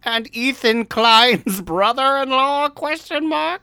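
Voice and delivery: high, put-on royal voice; hammy high-pitched queen impression; Queen Elizabeth voice. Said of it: "Liz said".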